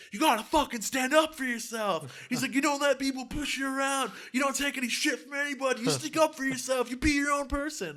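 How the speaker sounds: high, strained frontman rallying the crowd; impersonated punk frontman exhorting. Retold or imitated. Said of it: "lead singer of Suicidal Tendencies is like".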